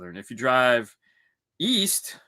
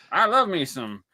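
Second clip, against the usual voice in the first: mock Southern accent; 5 semitones higher than usual. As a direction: put on a folksy Southern twang. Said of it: "They don't speak like he speaks".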